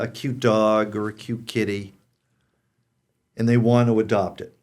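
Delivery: usual speaking voice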